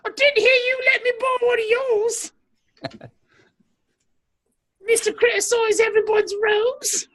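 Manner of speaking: high, put-on British with a fake sob; wounded English judge, hammed up; mock British whine.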